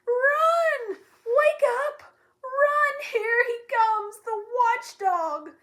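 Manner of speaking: high, loud frantic yells; terrified crowd shouting alarm; panicked shouting. Acted. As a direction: scream the warning as you run. Villagers flee the watchdog.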